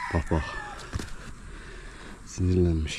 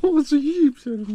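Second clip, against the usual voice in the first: high, louder doting squeal; 6 dB louder than usual. Real, not acted. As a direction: squeal with affection at the goat. Gushing over goat.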